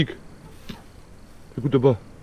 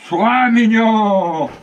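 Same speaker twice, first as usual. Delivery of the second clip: slow, higher, pleading coax; coaxing dog; 6 semitones higher, 5 dB louder, much slower than usual. Real, not acted. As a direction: stretch it out to calm him. Soothing the dog.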